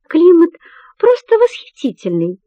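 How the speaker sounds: bright, upbeat, high-pitched start; cheerful. Acted. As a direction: sound cheerful while hiding anger. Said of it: "бодро отвечает Селения".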